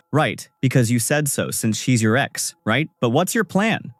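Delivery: plain reading voice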